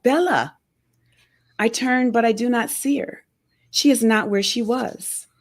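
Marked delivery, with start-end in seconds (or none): 0.0-0.5 high-pitched call that drops sharply; mild narrated yell. A narrator's reading. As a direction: call the name out urgently, falling at the end. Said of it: "Nan yells out".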